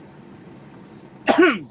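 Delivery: loud rough cough-like throat clear; choking throat clear. Real, not acted. Streamer choked on his coffee, loud throat clearing. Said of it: "Getting a little choked on my coffee there".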